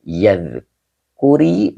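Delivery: "yadhkuri" said slowly, syllable by syllable, yadh-ku-ri; slow teaching demo of "yadhkuri"; slow drawn-out reading. Shown as a demonstration. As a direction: spell out "yadhkuri" slowly for the class. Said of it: "lamnya huruf nafi".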